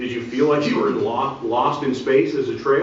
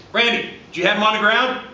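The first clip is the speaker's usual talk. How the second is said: on the ground? rising, higher and louder; asked as a question; 8 semitones higher, 4 dB louder than usual. Shown as a demonstration. Referee asking partner Randy in front of coaches, rising question. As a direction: make it a real question. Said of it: "I phrased it as a question".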